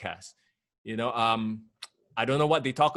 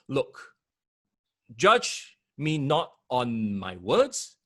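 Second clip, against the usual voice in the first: ordinary talk, nothing marked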